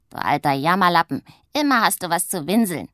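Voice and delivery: loud mocking scold. A narrator's reading. Witch scolds her moaning sister, loud scornful scold. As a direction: tell off the whiner. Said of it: "schimpft Pumpernickel".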